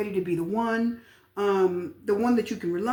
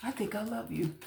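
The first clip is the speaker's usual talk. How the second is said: barely voiced mock-shy murmur; hesitant hushed confession; 9 dB quieter than usual. Reader showing how the admirer talks, hushed. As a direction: imitate a secret admirer under the breath. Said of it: "they're kind of talking like that".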